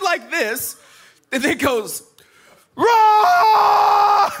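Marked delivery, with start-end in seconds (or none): 2.8-4.3 loud, high, drawn-out mock roar; full-voice imitation of a child's roar; toddler lion roar. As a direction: roar like a little boy playing lion. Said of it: "he starts roaring at Luna".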